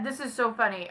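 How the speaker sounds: ordinary talk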